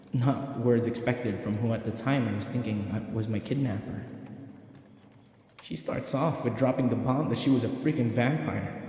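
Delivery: plain reading voice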